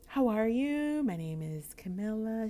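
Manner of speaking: high-pitched, over-friendly introduction; nervous introduction. Retold or imitated. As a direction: introduce yourself with put-on nervous sweetness. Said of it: "Like, I'm nervous".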